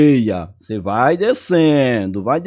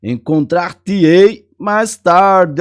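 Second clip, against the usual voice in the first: "encontrar-te-ei" spelled out slowly, a bit louder; slow example reading; 3 semitones higher, 4 dB louder, much slower than usual. Shown as a demonstration. Teacher reading the mesóclise example aloud slowly. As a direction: read "encontrar-te-ei mais tarde" slowly, stressing the hyphen breaks.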